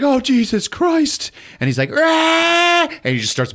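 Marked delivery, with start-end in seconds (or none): 0.0-1.3 high, slow breathless relief; terrified gasp. Retold or imitated. Sarah after the empty gun clicks, terrified gasp. 1.9-2.9 high, loud attacking yell; feral roar. Retold or imitated. Possessed boy attacking Sarah with the rifle, feral roar.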